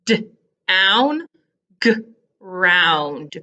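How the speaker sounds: D, pause, OWN, G, pause, ROUND; slow, segmented d...own, g...round; sounded-out onsets. Shown as a demonstration. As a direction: split own and round from their first sounds. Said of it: "the beginning sound has to be different".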